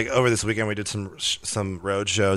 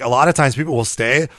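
ordinary delivery

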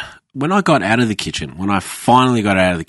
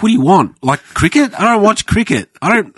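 mock outburst; 11 semitones higher, 4 dB louder than usual. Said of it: "it's like".

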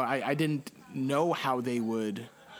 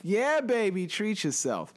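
playful; 7 semitones higher than usual. Acted. Playful echo of treat yourself.

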